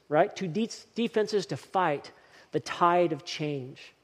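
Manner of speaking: usual speaking voice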